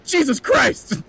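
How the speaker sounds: high mock cry of exasperation; mock exasperated cry. Steve despairing at Bucky's chatter, high mock cry. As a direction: squeak out the curse in disbelief.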